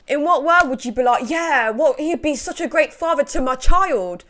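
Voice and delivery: incredulous rant at the wife; outraged. Real, not acted. Vlogger ranting about the wife, loud outrage. Outraged rhetorical mock quote.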